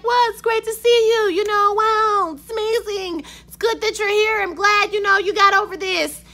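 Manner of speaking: high, sugary editor voice; fawning boss greeting her back; gushing editor. Retold or imitated. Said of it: "the editor's like".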